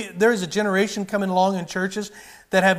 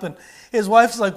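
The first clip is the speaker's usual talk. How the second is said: normal talking voice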